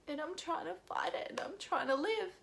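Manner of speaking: tearful near-whisper, voice giving out; real crying. Real, not acted. Telling viewers she is fighting her illness, crying. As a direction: whisper it through real tears. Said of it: "I'm just gonna cry".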